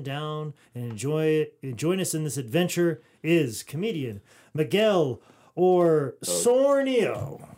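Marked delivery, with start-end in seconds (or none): 5.6-7.5 Osornio with an extra R after the first O, "Or-sornio"; mispronounced surname. Real, not acted. Host introduces the guest comedian, Osornio mispronounced as "Or-sornio". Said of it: "you added an R".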